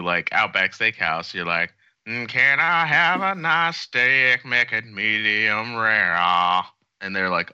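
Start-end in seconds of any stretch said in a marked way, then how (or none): 2.1-6.1 high, slow soulful singer voice; [name] voice. Retold or imitated. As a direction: order steak like [name] sings. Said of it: "if you're [name]".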